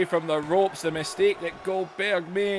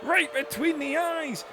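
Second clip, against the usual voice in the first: excited play-by-play; 9 semitones higher than usual. Acted. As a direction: yell the cover like it matters.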